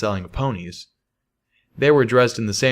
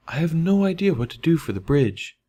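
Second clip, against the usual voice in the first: higher, sheepish admission; 8 semitones higher than usual. A narrator's reading. Velvet sheepishly admitting to Calamity she is stuck. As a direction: admit it a little embarrassed. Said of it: "Velvet admitted a little sheepishly".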